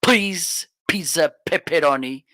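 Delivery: "please, pizza, pepperoni" with every P punched; plosive demonstration. Shown as a demonstration. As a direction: pop the P's hard in "please, pizza, pepperoni". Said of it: "not getting those plosives like".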